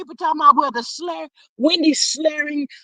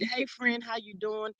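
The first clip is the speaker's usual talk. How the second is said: soft talk-show host greeting; Wendy Williams voice; 14 dB quieter than usual. Retold or imitated. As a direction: lightly do a talk-show diva's "how you doin'". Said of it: "In my Wendy Williams voice".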